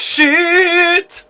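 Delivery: high, drawn-out panicked cry; panicked yell. A narrator's reading. David chased by zombies, panicked yell. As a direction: stretch the curse into a high yell.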